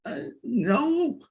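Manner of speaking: high, frightened squeak; squeaky voice. A narrator's reading. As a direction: squeak a nervous denial. Scared Wilbur answers.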